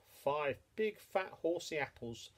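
ordinary delivery

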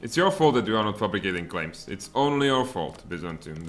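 usual speaking voice

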